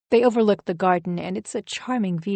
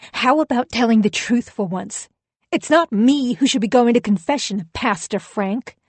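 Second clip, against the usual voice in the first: rising to an angry near-shout, louder and higher; almost shouting; 4 semitones higher, 5 dB louder than usual. Acted. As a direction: let the anger boil over toward a shout. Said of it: "I'm almost shouting now".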